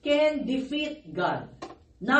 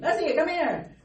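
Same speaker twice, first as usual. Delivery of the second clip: higher-pitched mock call to a pet; calling the dog; 6 semitones higher, 4 dB louder than usual. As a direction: call your dog over, re-enacted. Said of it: "I would call him".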